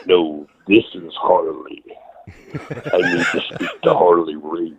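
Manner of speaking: slow, drawn-out prank-call voice; Harley Race impression. Student insists to his trainer that he is him, Harley Race impression. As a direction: insist slowly in the old wrestler's own voice. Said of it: "I used to call him as Harley".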